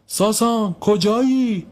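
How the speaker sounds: high, projected searching call; two lifted cries, name then question; shouting for someone. A narrator's reading. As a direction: call into a dark cellar for a boy. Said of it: "بلند داد زد".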